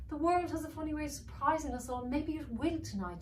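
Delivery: high, lighter motherly voice; hopeful mother dragon, lifted up high. Acted. Mother dragon comforting her husband, high and hopeful. Maeve speaks.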